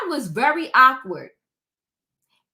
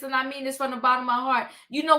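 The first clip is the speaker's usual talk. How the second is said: normal talking voice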